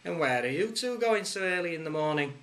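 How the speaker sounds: playful copper's question; lightly voiced policeman. A narrator's reading. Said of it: "he said".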